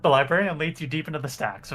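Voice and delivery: ordinary delivery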